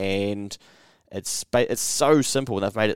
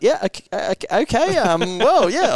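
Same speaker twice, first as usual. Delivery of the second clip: high, rising, flustered stammer; a caught-off-guard salesman scrambling, pitched way up; 12 semitones higher, 5 dB louder than usual. Retold or imitated. Salesman reacting to the customer, flustered and higher. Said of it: "And he's like".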